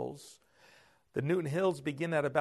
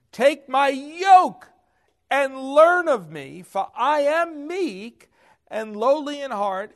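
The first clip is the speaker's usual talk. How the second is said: high, loud and slow scripture proclamation; preacher booming Jesus' words to the congregation; preaching at full force; 10 semitones higher, 11 dB louder, slower than usual. Acted. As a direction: proclaim the verse to the whole room.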